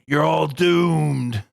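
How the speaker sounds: slow ominous raspy drawl. Retold or imitated. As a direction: drag out doomed like a prophet of doom. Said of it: "and he's like".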